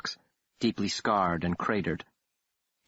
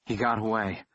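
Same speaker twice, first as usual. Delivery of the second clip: gasped. Acted. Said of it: "I gasped".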